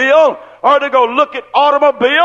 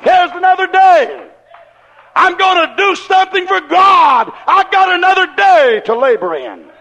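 joyful shout; 7 semitones higher than usual. Retold or imitated. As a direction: shout it out to God with joy. Joyful morning praise shouted.

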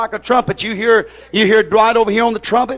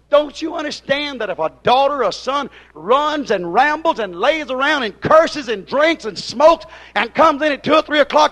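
fiery preaching; 5 semitones higher than usual. Acted. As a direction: preach hard, demanding an answer. Preacher rising into full-force preaching.

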